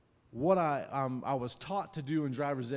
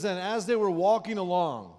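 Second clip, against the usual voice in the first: raised, projected Bible-reading voice; loud, higher-pitched pulpit reading; scripture reading; 6 semitones higher, 7 dB louder than usual. Acted. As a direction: read the verse out loud to a congregation. Reads scripture aloud to the congregation.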